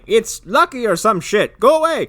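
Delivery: high, loud shoo; shooing yell. Retold or imitated. Grandpa shooing Russell away, high loud yell. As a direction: shove him off with a yell. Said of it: "He's like".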